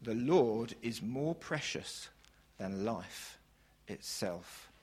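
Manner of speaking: preacher slows and sinks each word into a hush; slow fading emphasis. Shown as a demonstration. Preacher repeating the key line to his congregation, slowly fading to a hush. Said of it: "I'm gonna say this twice".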